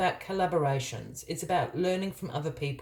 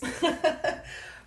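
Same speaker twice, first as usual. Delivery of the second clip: high giggle at own hiccup; breathy little laugh; embarrassed laugh; 12 semitones higher than usual. Real, not acted. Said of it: "I've got the hiccups".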